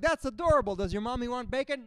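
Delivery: hammed-up sweet waitress; mock cooing waitress. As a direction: coo at a little kid. Waitress talking to child.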